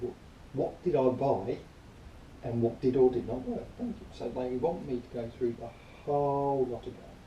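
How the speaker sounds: ordinary talk, nothing marked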